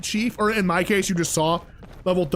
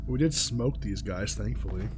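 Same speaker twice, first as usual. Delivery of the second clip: slow, strained, lower and softer; holding back a cough; 7 semitones lower, 8 dB quieter, slower than usual. Real, not acted. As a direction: talk while suppressing a cough. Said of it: "so I'm holding the cough down".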